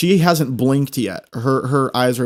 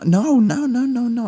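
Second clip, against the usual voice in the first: high protesting no; 10 semitones higher than usual. Retold or imitated. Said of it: "And I, I said".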